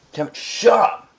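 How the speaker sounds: snapping. Real, not acted. Snapping at brother.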